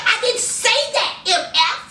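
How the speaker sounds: high-pitched mock indignation; indignant denial. Host as Nicki denying it to Joe, indignant. Nicki snapping back, retold.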